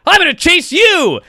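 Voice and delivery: high, loud cartoon taunt; cartoon little guy. Little cartoon guy turning on the big guy, high and loud. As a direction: turn the tables with a squeaky challenge. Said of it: "He's like".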